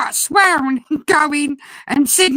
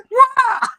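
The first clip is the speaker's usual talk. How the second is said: a much higher, stunned what; shocked what; 10 semitones higher than usual. Retold or imitated. Hearing the parrot's insult, a stunned high what. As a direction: blurt out disbelief.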